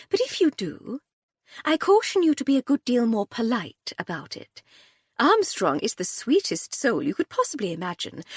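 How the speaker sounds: a hint of a breezy, amused aristocrat; lightly voiced Lady Hardcastle. A narrator's reading. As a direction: lightly play the unruffled lady, teasing the inspector. Lady Hardcastle warns Cordelia.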